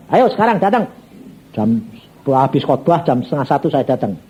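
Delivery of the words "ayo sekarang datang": high, insistent order; urgent command. Retold or imitated. God telling the preacher to go now, commanding. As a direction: urge him to come at once.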